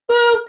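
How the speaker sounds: high, loud little boop; mock device boop. Shown as a demonstration. Host showing viewers how the connector clicks, a high boop. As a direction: chirp it like a gadget clicking in. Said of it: "you're gonna go".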